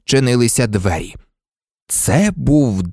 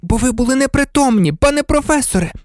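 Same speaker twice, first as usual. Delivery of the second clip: put-on high, matter-of-fact girl's voice; frank, high-pitched rebuttal; 13 semitones higher, faster than usual. A narrator's reading. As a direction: correct a teacher candidly. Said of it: "відверто сказала Герміона".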